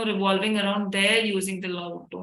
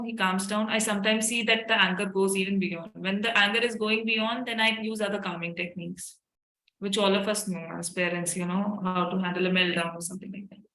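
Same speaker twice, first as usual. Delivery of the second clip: usual speaking voice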